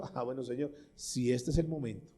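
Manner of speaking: soft, quiet surrender to God; calm trusting prayer. Acted. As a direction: pray it softly, at peace. Acted calm prayer during earthquake. (oración serena y suave de quien no teme al temblor)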